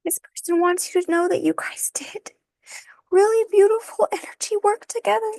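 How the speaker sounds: pushing the words out through real tears; crying while delivering the message; tearful. Real, not acted. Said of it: "I'm gonna cry".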